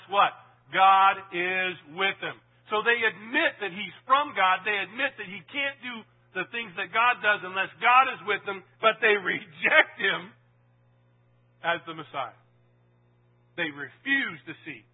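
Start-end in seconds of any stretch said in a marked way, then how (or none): 0.7-12.4 preaching. Acted. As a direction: proclaim it to the congregation. Preaching at full force.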